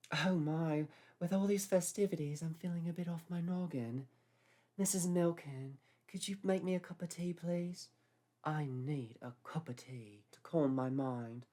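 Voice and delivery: put-on high, genteel old English lady; posh older lady. A narrator's reading. Babcock voiced.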